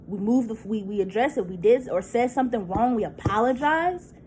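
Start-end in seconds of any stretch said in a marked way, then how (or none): none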